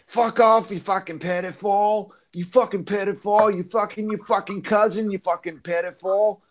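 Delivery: high-pitched imitation of a drunk row; mock calling-out. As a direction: hurl insults like a squabbling teen neighbor. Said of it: "one of them was calling another one out".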